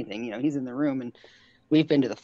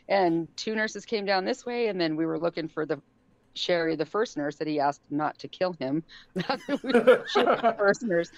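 ordinary delivery

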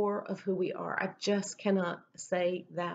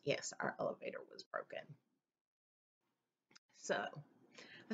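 whispered aside; 4 semitones lower, 13 dB quieter than usual. Real, not acted. Side remark about broken elevator.

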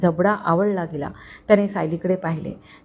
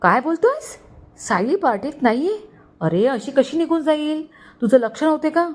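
higher, shocked phone questions; startled, demanding answers; alarmed disbelief; 7 semitones higher than usual. A narrator's reading. Uncle alarmed on phone call.

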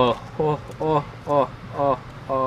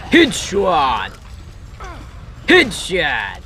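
triumphant call; much slower than usual. Pretend. Calls out a kill in game.

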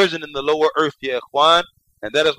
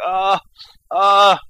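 louder groan, like someone in pain; 5 semitones higher, 4 dB louder than usual. Shown as a demonstration. Teacher acts out the dead man groaning in pain. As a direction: moan "Ah, ah" as if hurting. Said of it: "he is in such pain".

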